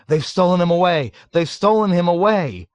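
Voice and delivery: high, loud repeated outcry; screaming. A narrator's reading. People all over the ward, screaming. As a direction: scream it in mounting panic. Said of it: "all over the ward began screaming".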